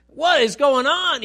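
put-on high, loud, slow baby bewilderment; a newborn asking in shock what is happening; talking baby. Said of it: "Could you imagine a child talking".

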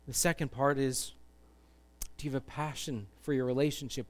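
soft, low, hushed invitation; earnest altar appeal. Real, not acted. Preacher inviting listeners to place faith in Jesus, hushed and earnest. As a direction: appeal gently, leaving long pauses. Altar call and led prayer.

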